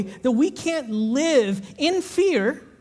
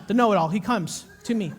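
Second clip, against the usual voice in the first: ordinary talk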